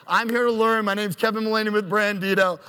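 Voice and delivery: normal talking voice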